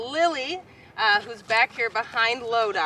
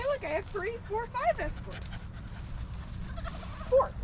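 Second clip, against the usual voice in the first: high playful voice; 13 semitones higher, 6 dB quieter than usual. Pretend. Showing off to the goats.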